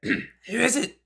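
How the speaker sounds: strained high raspy croak. Acted. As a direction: rasp it through the door. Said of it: "a raspy voice from the other side".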